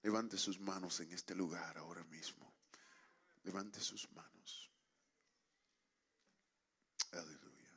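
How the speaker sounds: low, soft praying with long pauses; solemn prayer. Real, not acted. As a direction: lead the congregation quietly into earnest prayer. Leads congregation in prayer.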